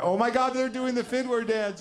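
very high, loud squeal; mock panic pitched right up; hysterical excitement. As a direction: shriek like a thrilled, alarmed safari tourist. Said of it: "with, like, the same energy".

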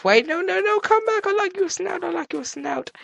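very high, playful plea; high-pitched pleading. Pretend. Player begging a pig to come back, high-pitched pleading. Calling after fleeing pig.

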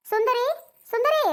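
high-pitched summoning call; name called out high, rising on the repeat. Acted. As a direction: call a name to fetch someone. Calling Sundari from outside.

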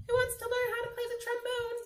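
high, fast little baboon voice; a high chattering baboon asking; squeaky baboon voice. Acted. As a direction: pipe it up like an excited baboon. Baboons voiced in read-aloud book.

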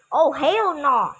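loud, slow, high hell no; emphatic refusal. Real, not acted. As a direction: drag out the refusal loudly. Refusing the extreme level.

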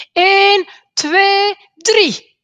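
hoog en luid aftellen; aftellend roepen. A narrator's reading. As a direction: tel opgewonden af. Kinderen tellen samen af. (kinderen tellen samen af bij de kast)

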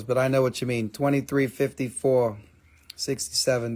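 ordinary talk, nothing marked

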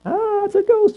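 telling the class how the disciples cried out at the ghost, in a high mock shriek; mock frightened yell. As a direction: squeal it like a scared disciple. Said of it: "And they yelled".

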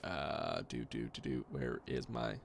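low, quiet robot voice; monotone robot voice, droning low. Real, not acted. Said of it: "I also sound like a robot to myself".

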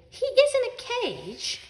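high, slow, indignant retort; indignant protest. A narrator's reading. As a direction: stick up for him, pitched high. Ellie sticks up for cat.

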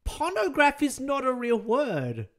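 loud, high, drawn-out objection; outraged protest. Real, not acted. Streamer protesting it is not a real word, outraged. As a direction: belt out each word slowly in disbelief.

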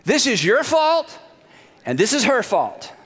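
high, self-righteous blame; mock-indignant. Adam tells God and Eve to apologize, mock-indignant.

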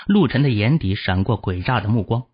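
usual speaking voice

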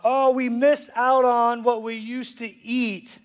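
slow drawn-out whine; mock whining. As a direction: drag it out like a sulking complainer. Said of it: "complaining about".

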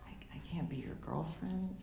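soft, hushed whisper, barely voiced. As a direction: break it to her gently in a whisper. Robin gently turning her down.

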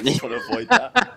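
laughing through the words. Real, not acted. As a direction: giggle while you say it. Laughing at herself.

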